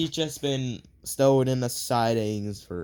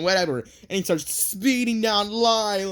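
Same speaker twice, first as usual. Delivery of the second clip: ordinary talk, nothing marked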